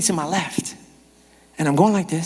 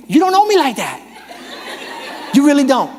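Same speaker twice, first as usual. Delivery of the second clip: indignant protest; 7 semitones higher than usual. Retold or imitated. Preacher mentally telling off the whispering stranger, offended. Acting out his upset thoughts.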